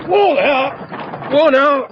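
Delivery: high, loud call to halt the horses. Acted. Driver hauls in the horses with a loud, high call. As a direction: shout the team to a stop.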